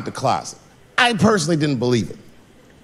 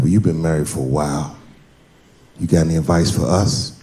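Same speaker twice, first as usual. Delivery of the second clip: friend voice; 10 semitones lower than usual. Retold or imitated.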